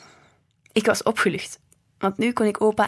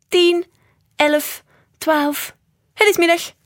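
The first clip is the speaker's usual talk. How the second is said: high, loud sing-out of each number; counting out loud; 9 semitones higher, 6 dB louder, slower than usual. A narrator's reading. Grandpa counting along with the cuckoo, loudly. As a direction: call out the count high and strong. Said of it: "telde luidop mee".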